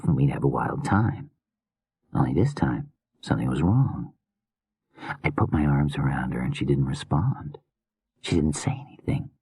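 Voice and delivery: soft broken whisper; hushed, wounded madman; whispering grieving killer. Acted. Killer recounts wife's leaving.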